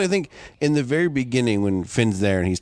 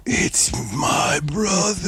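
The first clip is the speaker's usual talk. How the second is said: slow, awestruck dawning of the truth; stunned realisation; 5 semitones higher, much slower than usual. Retold or imitated. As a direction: let it sink in slowly, half-whispered. Acting out Finney's realisation.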